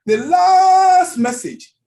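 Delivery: high, loud and very slow; drawn-out shout. Real, not acted. Preacher to congregation, "the last message" shouted long and high. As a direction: stretch out "the last message" in a big high call. Preacher stressing Jesus' final words.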